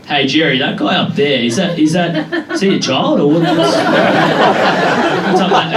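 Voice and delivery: usual speaking voice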